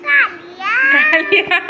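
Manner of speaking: very slow, high and loud, dragged out; child's nickname. Retold or imitated. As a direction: drag the name out like the little one says it. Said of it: "ওর দিদিকে বলবে কালিয়া". (খুব টেনে, উঁচু গলায় নাম বলা)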